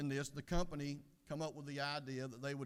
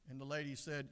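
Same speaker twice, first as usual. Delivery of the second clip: ordinary talk, nothing marked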